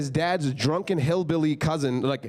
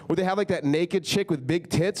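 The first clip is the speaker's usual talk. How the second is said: usual speaking voice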